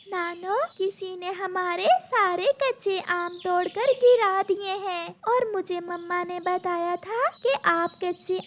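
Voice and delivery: a small granddaughter explaining to grandma; little girl voice. A narrator's reading. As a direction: tell grandma the whole story.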